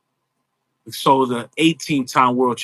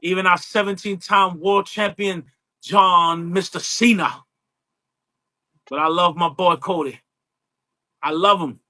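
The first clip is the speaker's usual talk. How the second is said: higher, drawling Southern preacher voice calling everyone honey; Dusty Rhodes impression; 5 semitones higher than usual. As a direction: imitate the old wrestler sweet-talking his fans. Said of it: "That's a good impression".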